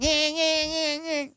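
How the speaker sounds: high repeating alarm wail; imitated alarm. Homeowners playing the Ring alarm at him, imitated high siren. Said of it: "the alarm through the Ring. It's going".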